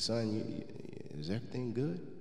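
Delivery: soft, hushed fatherly asking; gentle concern. Retold or imitated. Dad quietly asks his son if all is well. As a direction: ask gently, like a worried dad at the car.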